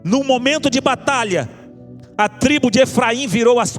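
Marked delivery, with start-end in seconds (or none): none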